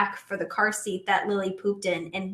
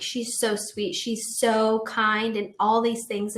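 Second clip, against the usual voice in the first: ordinary talk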